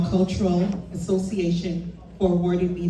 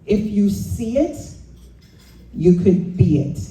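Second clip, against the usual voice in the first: light Jamaican accent, retold; a hint of mom's Jamaican lilt; mother's Jamaican accent. Daughter quoting her mother's advice, in a light Jamaican accent. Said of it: "in her Jamaican accent".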